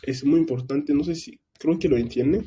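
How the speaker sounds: usual speaking voice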